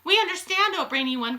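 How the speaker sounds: eager audience calling out; crying out. A narrator's reading. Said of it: "cried the audience".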